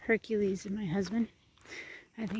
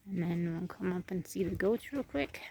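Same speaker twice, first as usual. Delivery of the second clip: ordinary delivery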